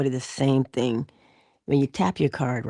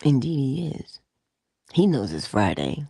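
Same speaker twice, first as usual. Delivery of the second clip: ordinary talk, nothing marked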